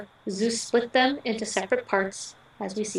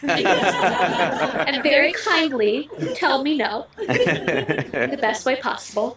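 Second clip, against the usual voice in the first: slow words through real laughter; laughing; 3 semitones higher, 4 dB louder, slower than usual. Real, not acted. Bride laughs while telling groom about the third cat.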